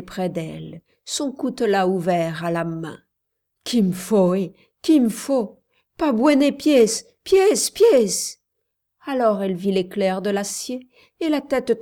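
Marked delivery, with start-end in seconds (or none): 3.6-8.4 loud shouts climbing to a high cry; urgent shouting. A narrator's reading. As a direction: shout repeated warnings, building in urgency. Commander shouting during snake attack. (cris pressants qui montent)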